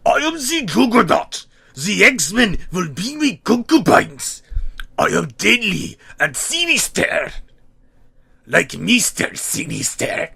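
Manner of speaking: hammed-up German villain shouting, rising to a shriek on the last sinister; shouted German accent. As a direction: rant it like a cartoon villain in a heavy German accent. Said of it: "shouting in a thick German accent".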